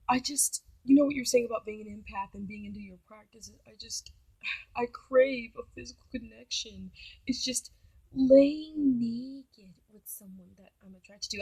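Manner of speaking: imitating him, halting and hushed; soft mock pleading. Date craving physical connection, soft mock pleading. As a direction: drag it out softly, as if wounded.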